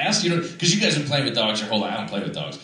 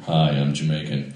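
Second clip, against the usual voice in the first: lower, badly faked Jamaican voice; deeper Jamaican accent; 8 semitones lower than usual. Shown as a demonstration. Comic demonstrating his only accent, deeper mock Jamaican. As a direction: drop the pitch for a token Jamaican accent. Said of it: "It's just my voice deeper".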